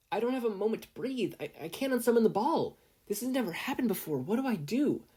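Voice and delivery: high, fast, panicked thoughts; a boy panicking as his ball goes wild, high and rushed; frantic inner panic. A narrator's reading. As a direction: rush it out in a panic. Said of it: "Izuku thought to himself frantically".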